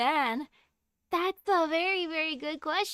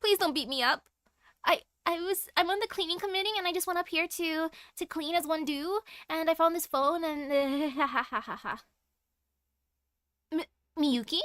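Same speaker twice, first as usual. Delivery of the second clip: higher, stammering mock-timid excuse; nervous pleading; 3 semitones higher than usual. Student caught on the roof begging off, nervously pleading. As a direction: babble an excuse, ending in an awkward laugh.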